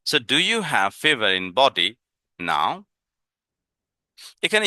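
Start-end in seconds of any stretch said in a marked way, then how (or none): 0.1-2.8 very slow, each word drawn out for learners; teacher modelling English slowly; slow modelled question. Shown as a demonstration. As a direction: spell it out slowly for the class.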